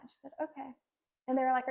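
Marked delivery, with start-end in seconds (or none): 0.4-0.7 small, higher, softer imitation of a child; underwhelmed little reply, imitated; flat. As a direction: give a soft, unimpressed child's okay. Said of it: "like, flat".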